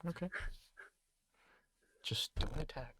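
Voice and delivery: soft whispered aside. Real, not acted. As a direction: whisper it just to the player.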